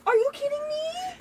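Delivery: high gasping disbelief; gasp, then squeak it out in shock. Real, not acted. Gasp at wedding news.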